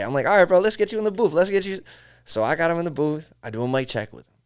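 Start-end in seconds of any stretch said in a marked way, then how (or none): 0.3-1.8 pitched way up, loud and eager; hyped reassurance. Retold or imitated. Engineer hyping up a doubtful rapper before recording, eager and loud. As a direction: pump him up to get in the booth.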